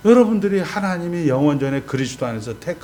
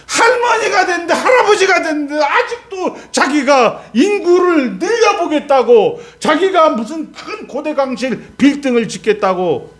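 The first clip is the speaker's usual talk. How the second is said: laughing mockery; 13 semitones higher, 5 dB louder than usual. Real, not acted. Mocking old people's big plans.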